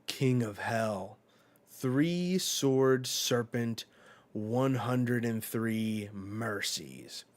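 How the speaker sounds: slow, dramatic attack name; a slow, loud, dramatic battle cry; attack call. Retold or imitated. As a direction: draw out each word of the move. Zoro calling out his attack.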